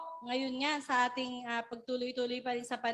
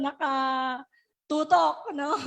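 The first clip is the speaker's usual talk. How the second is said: laughing; 7 dB louder, much slower than usual. Real, not acted. Laughing through the joke.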